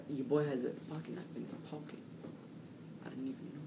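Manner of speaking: ordinary delivery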